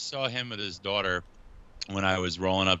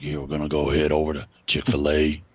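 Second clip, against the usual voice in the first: low, loud put-on voice; Josh Fisher; 4 semitones lower, 5 dB louder than usual. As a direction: order fast food in an overblown, lowered voice. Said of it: "He talks like that all the time".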